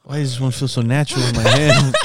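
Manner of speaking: laughing through a joke; giggly mock wonder. Joking about dildo handle.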